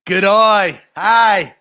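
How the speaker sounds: put-on Australian accent. Imitating Nate for his cohost in an Australian accent. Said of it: "He talks like this".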